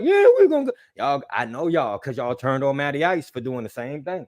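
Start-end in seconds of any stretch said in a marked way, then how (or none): 0.0-0.7 high mocking fan voice. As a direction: squeak it like a naive fan. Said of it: "y'all who was like".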